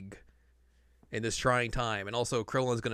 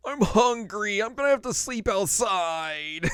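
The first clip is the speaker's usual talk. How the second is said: mock sulk; 9 semitones higher, slower than usual. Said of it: "sulking outside, like".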